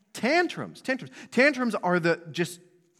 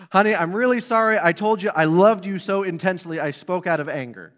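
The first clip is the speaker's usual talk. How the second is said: louder mock-sincere apology to a spouse, tongue in cheek; hammed-up apology; 2 semitones higher, 7 dB louder than usual. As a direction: overdo a sorry to your honey for laughs. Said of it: "we don't have to say".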